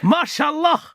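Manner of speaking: mock Arabic exclamation. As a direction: belt it out high in put-on Arabic. Put-on exclamation in Arabic, questioned.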